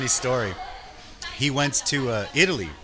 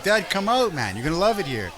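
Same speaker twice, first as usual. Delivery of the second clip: eager urging; 4 semitones higher than usual. Retold or imitated. Urging dad to visit.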